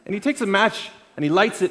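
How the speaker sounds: usual speaking voice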